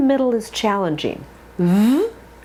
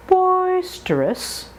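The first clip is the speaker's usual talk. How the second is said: very slow; 9 semitones higher, much slower than usual. Shown as a demonstration. Drill word stretched.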